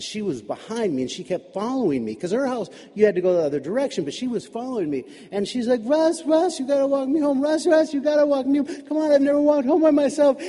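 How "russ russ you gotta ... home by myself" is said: high, insistent begging; urgent pleading. Acted. Girl chasing a classmate to walk her home, pleading. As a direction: plead urgently, pitched well up.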